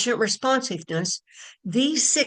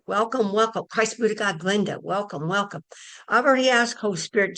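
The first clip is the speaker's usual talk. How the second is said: ordinary talk, nothing marked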